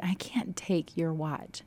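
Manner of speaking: slow, hushed whisper, near tears; whispered refusal. Retold or imitated. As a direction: breathe it out softly, overwhelmed. Moved, refusing the gift.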